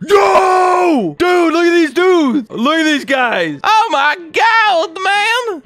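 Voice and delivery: high, loud, drawn-out amazement; amazed shouting. Real, not acted. Gamer shows viewers an astonishing find, amazed shouting. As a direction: holler in awe, stretching the words. Sees huge hidden thing in game.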